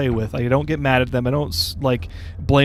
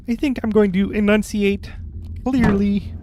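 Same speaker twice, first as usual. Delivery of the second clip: high, froggy puppet voice; Kermit voice; 6 semitones higher than usual. Acted. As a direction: talk like a frog puppet. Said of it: "my Kermit voice".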